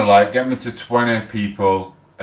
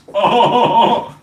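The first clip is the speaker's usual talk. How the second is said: loud laugh; 11 semitones higher, 6 dB louder than usual. Real, not acted. Laughing at own mockery.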